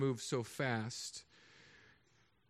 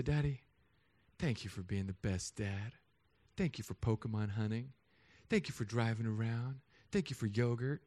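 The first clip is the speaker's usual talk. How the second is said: softer, lower, gentle thank-yous; sweet child thanks; 4 semitones lower, 4 dB quieter than usual. Retold or imitated. Preacher imitating his kids thanking him at bedtime, soft and sweet. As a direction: say it sweetly like a grateful little kid. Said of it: "they'll just come up and say".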